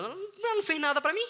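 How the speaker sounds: high, fast exasperated outburst. Retold or imitated. Retelling frustration with a hated coworker, exasperated. Retold exasperated inner thought.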